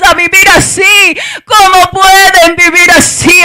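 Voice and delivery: loud, shouted pulpit exhortation; full-force preaching. Real, not acted. Mother urges church women to fight for their children, full-force preaching. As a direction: build to an anguished shout, stretching the final words.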